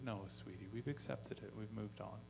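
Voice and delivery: put-on a father calming his little girl at bedtime; soft soothing dad. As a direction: gently let her down. Dad soothing daughter softly.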